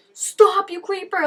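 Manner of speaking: loud, high outburst; real exasperated yell; annoyed yell. Real, not acted. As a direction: shout at the screen in disgust. Yelling at a character on screen.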